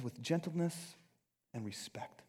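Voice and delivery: dropping softly to a near whisper; gentle. Shown as a demonstration. Preacher reading rest of verse, gentle and hushed. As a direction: ease down to a hushed finish.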